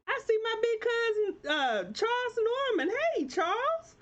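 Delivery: light up as you spot a loved one; excited greeting. Real, not acted. Host spotting her big cousin in the comments, thrilled.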